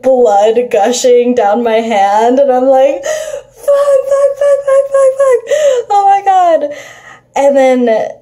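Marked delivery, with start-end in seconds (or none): 3.0-6.8 crying in pain. Retold or imitated. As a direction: wail the curses through the pain. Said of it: "screaming in pain".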